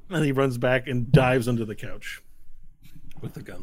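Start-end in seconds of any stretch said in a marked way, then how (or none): none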